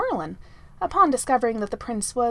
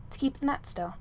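ordinary delivery

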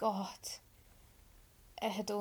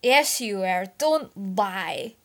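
loud belligerent retort; loud belligerent drunk retort; 12 dB louder, much slower than usual. Acted. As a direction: snap back, loud and drunk. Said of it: "Merlin countered belligerently".

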